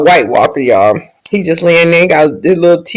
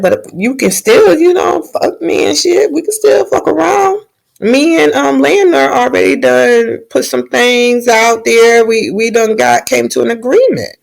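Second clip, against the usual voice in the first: pitched way up, playful coaxing; mock coaxing princess; 8 semitones higher than usual. Princess offering knight an affair, high playful coaxing. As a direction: talk him round sweetly and cheekily.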